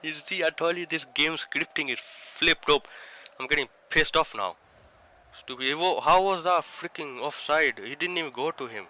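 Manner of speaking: loud exasperated gamer rage; furious rant. Real, not acted. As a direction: yell in outrage at the screen. Gamer raging at offside call.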